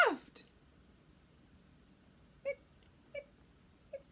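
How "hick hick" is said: usual speaking voice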